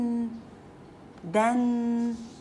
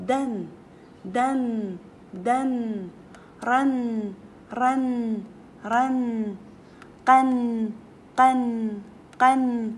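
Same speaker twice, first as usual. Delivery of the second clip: loud phonics drill; 5 dB louder, much slower than usual. Shown as a demonstration. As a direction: drill growing louder toward "قن". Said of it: "ويا الحروف". (تلقين بصوت يعلو تدريجيًا)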